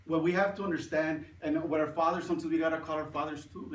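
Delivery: normal talking voice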